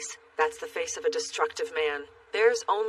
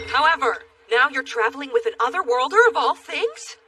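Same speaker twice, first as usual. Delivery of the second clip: indignant; 6 semitones higher, 7 dB louder than usual. Acted. Scolding disbelief.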